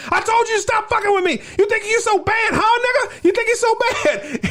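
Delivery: high-pitched crazed yell; frantic screaming soldier. Retold or imitated. Gunner screaming at the enemy, high frantic yell. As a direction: scream it like he's completely lost it.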